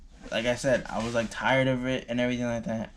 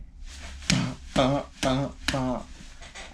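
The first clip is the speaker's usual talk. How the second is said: sly laugh; 2 semitones higher than usual. Real, not acted. Laughs before sex story.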